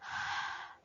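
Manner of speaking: breathy unvoiced H exhale; sound of H. Shown as a demonstration. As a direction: demonstrate the H sound as a long puff of air. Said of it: "How about the H?".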